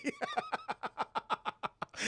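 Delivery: long high wheezy giggle; laughing. Real, not acted. Streamer amused by the donut hole joke, laughing. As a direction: crack up in quiet high-pitched bursts. Speaker cracks up at own joke.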